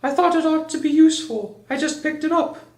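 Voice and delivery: very high little bear voice; Pooh's voice. A narrator's reading. Voicing Pooh in the story.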